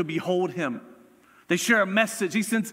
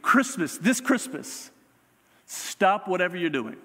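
usual speaking voice

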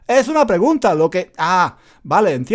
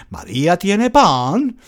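slow "¿María tiene pan?" with a rise on pan; question intonation; slower than usual. Shown as a demonstration. Teacher demonstrates the audible rising question, slowly. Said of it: "se escucha perfectamente".

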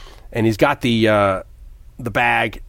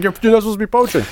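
high, fast hammed-up scold; mock scolding; 10 semitones higher, much faster than usual. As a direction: rattle off the reprimand pitched up. Connery scolds poacher, voiced high.

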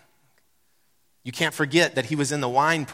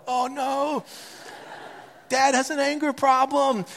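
higher, childlike mock alarm; mimicking the kids; 12 semitones higher, slower than usual. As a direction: play the kids tattling at the window. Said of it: "kids are at the window like".

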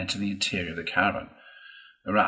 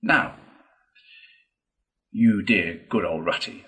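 imploring; 4 dB louder than usual. A narrator's reading. Toad begging Ratty to come along, imploring. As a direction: plead with a dear friend.

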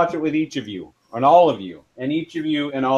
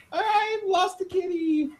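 mock sad; 8 dB quieter than usual. Cat gets away.

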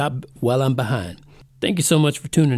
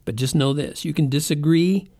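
ordinary talk, nothing marked

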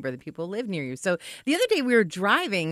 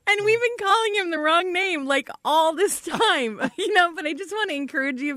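laughing; 9 semitones higher than usual. Real, not acted. Laughing through story.

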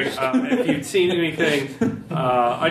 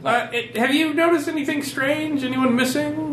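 character voice; 11 semitones higher than usual. Acted. Player character asks in role.

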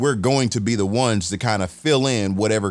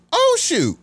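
much higher, startled oh shoot; surprised exclamation. Retold or imitated. Retelling to a friend his reaction to the dog breed, a high surprised yelp. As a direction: yelp it out like the news just hit you.

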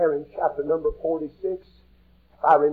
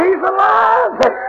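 hollering; 14 semitones higher, 5 dB louder, much slower than usual. Retold or imitated. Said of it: "they begin to holler".

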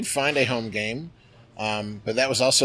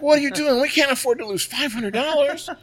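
much higher, louder scolding wife; mad wife; 6 dB louder than usual. Retold or imitated. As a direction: scold him, shrill with anger. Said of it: "And she was so mad".

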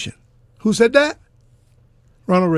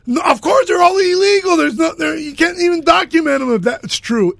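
angry; 6 semitones higher than usual. Real, not acted. Heated quarrel, exasperated outburst.

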